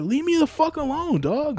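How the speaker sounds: very high-pitched, fed-up protest; retold exasperated plea. Guest repeating his brush-off to drunk partygoer, exasperated plea. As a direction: plead to be left alone, voice way up.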